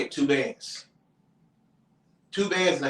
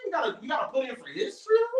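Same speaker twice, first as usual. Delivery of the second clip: indignant disbelief; 11 semitones higher, 3 dB quieter, faster than usual. Retold or imitated. Mock outrage at funeral collection.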